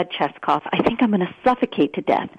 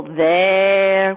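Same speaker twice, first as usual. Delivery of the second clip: "there" drawn out long; "there" held about twice as long; prolonged syllable. Shown as a demonstration. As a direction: stretch the vowel of "there" on a held note. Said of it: "prolonged the syllable".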